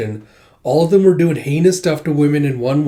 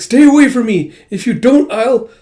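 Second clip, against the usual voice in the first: high, loud acted warning; frightened threat; 8 semitones higher, 8 dB louder than usual. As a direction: threaten him off in acted panic. Frightened girl warns him off.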